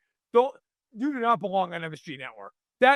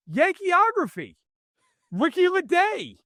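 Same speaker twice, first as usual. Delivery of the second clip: much higher, slow grand title read; mock TV announcer; 12 semitones higher, slower than usual. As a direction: announce the show title slowly and pompously, pitched way up.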